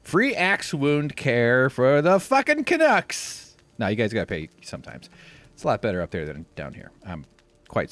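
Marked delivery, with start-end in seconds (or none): 0.0-3.6 high, loud, drawn-out jeering; sarcastic high-pitched holler; mock-envious outburst. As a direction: play up outraged jealousy, slowly and loudly. Mock envy at Canadian healthcare.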